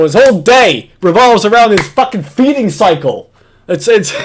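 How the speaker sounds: ordinary talk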